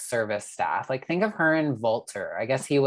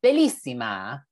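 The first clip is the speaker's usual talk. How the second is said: hammy faux Italian voice; Italian accent; 6 semitones higher, 5 dB louder than usual. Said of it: "[name] loves to be faux Italian".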